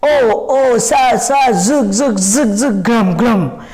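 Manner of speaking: chanted wind and thunder noises; rhythmic zug-zug, grum-grum falling in pitch; imitated storm sounds. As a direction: run the storm noises together in a steady beat. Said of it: "इतना सारे sound आया".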